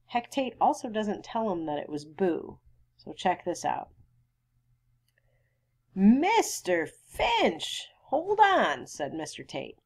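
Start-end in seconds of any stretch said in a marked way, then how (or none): 5.9-8.9 drawn-out, high-pitched acted appeal; urgent interjection. Sheriff stopping Mister Finch, acted urgent protest. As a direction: stretch the name out to halt him.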